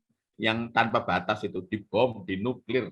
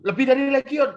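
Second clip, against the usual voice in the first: high, loud disbelief; mock incredulous question; 12 semitones higher, 7 dB louder than usual. Friend reacts to demon's boast, loud mock disbelief.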